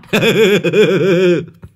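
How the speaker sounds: high, loud cackling laugh; loud cackle. Acted. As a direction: burst into a monkey-like cackle. The two burst out laughing. (tiếng cười khành khạch to và cao)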